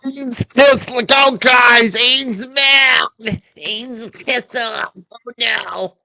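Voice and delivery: high, slow hammed-up warning; mock alarm. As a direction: pretend to panic over his anger. Mock alarm about Aiden's anger.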